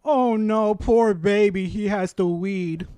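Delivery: mock pity, slow at the end; gloating fake sympathy. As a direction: coo over him like a sad baby. Mocking streamer's complaint.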